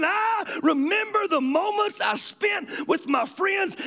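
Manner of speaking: quoted line shouted high and slow; impassioned quote. Retold or imitated. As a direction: cry out the prisoner's words, slow and high. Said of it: "he's saying".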